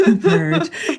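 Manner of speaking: high squeal through laughter; laughing. Real, not acted. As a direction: crack up on it. Laughing joke.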